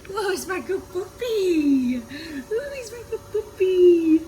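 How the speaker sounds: dog voice. Real, not acted. Owner gushing at her puppy in dog voice. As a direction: gush over the puppy, almost in tears. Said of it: "talking a dog voice all week".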